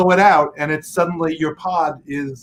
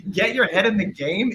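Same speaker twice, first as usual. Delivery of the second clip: higher, questioning retort, not shouted; incredulous echo; 5 semitones higher than usual. Retold or imitated. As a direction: throw it back as a disbelieving question. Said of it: "And I was like".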